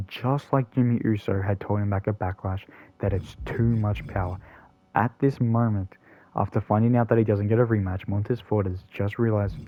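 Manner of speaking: ordinary talk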